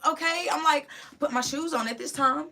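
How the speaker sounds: high, loud, frantic and near tears; blurting it out in a panic; shouting through rising panic. Real, not acted. Frantic retelling, crying shout.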